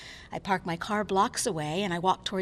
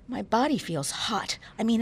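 plain reading voice

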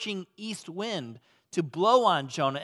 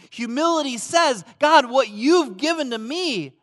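loud, high preaching voice; full-force preaching; 7 semitones higher, 10 dB louder than usual. Acted. Pastor proclaiming what humility says, loud and high. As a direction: lift it up with conviction.

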